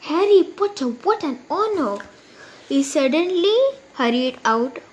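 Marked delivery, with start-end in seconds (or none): 0.1-2.0 a hint of an old barman, higher and awed. Old barman to famous Harry, lightly voiced and awed. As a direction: gasp out the name in wonder.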